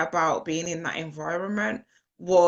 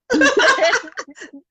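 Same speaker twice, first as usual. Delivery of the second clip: loud, high laughing fit; big burst of laughter; 10 dB louder than usual. Real, not acted. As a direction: crack up at your own joke. Laughing at her own comeback.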